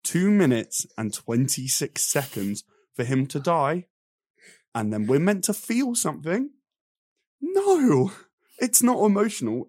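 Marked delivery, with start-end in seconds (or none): 4.7-9.7 much higher and louder, fed up; exasperated rant. Real, not acted. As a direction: push the outrage up high. Ranting about kid's death scene.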